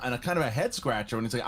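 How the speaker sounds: ordinary talk, nothing marked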